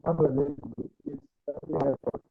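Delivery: usual speaking voice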